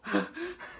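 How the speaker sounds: short high giggle; quick amused laugh; light giggle. Real, not acted. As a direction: giggle at your own joke. Laughs at own reaction.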